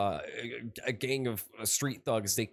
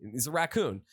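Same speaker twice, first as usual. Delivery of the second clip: normal talking voice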